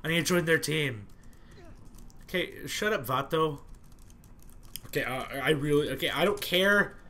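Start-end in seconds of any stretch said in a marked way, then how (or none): none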